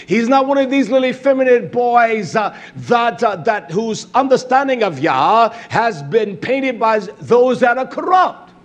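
rally the men like a war cry; preacher building to a shout; rousing preaching. Acted. Preaching, rising intensity.

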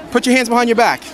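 usual speaking voice

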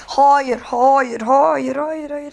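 loud, desperate repeated no; panicked no. Real, not acted. Gamer pleading as the neighbor chases him, panicked. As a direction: beg the chaser to stop.

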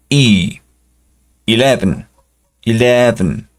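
"eleven" with the first E said like the letter E. Shown as a demonstration. Teacher models "eleven" for the class. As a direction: start "eleven" with the letter E sound. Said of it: "se pronuncia como si estuviera sola".

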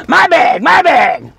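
high, very loud mock yell; explosive retold outburst; scary possessive shout. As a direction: shout it to scare someone off. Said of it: "I scared her".

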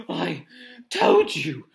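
out of breath, punching "told"; gasped. Acted. Hermione scolding the boys after running, gasping. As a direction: gasp it between breaths, loud on "told". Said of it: "Hermione gasped".

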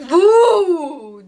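long, loud "vuu"; hammed-up "vuuu", rising then sliding down; drawn-out wooing. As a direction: stretch out a loud woo. Reads out a comment's wow sound.

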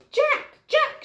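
very high, loud calling out; roaring mum. Acted. Mum searching the forest for her son, loud and high. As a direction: bellow his name like an angry mum. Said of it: "she roared".

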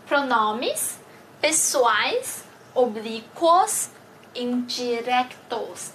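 each word drawn out slowly for learners to repeat; slow model reading. Shown as a demonstration. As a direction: read "Pronomes pessoais oblíquos indirectos" slowly, syllable by syllable. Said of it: "我们一起来读一遍". (慢速示范朗读)

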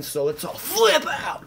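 high excited shout; hype shout. Acted. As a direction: whip the crowd up on this. Hyping the viewers up.

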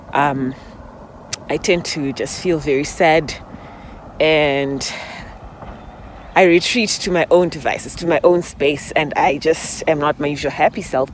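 usual speaking voice

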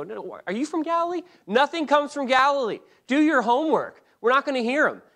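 high, loud dismissive retort; acted scornful rebuke. Pharisees scornfully silence Nicodemus.

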